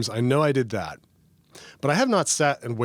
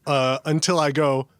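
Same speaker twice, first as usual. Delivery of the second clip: ordinary delivery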